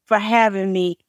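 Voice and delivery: ordinary talk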